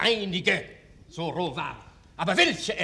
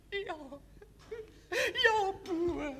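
tearful mother; much slower than usual. Acted. Mother answers dying son.

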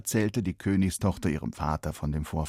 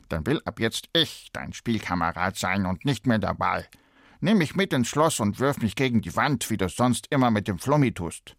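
a sly little frog laying down his terms; slippery frog voice; 2 semitones higher than usual. A narrator's reading. Frog bargaining with the princess, lightly voiced frog. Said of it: "sagte der glitschige Frosch".

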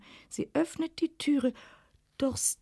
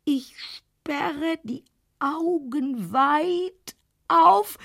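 slow straining build; 4 semitones higher, much slower than usual. A narrator's reading. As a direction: push each word out, building. Else erwacht mühsam. (mühsamer, steigender Kraftakt)

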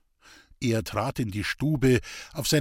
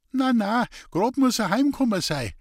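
high, louder anxious protest; agitated insisting; 10 semitones higher, 4 dB louder than usual. Acted. Old man insists his son came home, high and anxious. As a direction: insist he must just be home.

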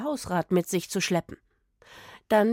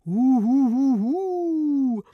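high, louder playful cooing; mock pigeon hoots; 6 semitones higher, 4 dB louder, much slower than usual. A narrator's reading. Boy imitating pigeons flying away, mock hoots. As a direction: coo like fluttering pigeons. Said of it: "Er flatterte mit den Händen".

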